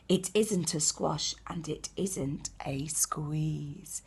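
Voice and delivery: slow whisper to close; whispered ending. A narrator's reading. Teacher whispering the last line to the children. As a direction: hush it as the story ends.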